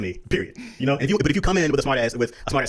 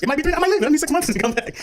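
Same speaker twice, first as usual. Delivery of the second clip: joking exaggeration; 13 semitones higher than usual.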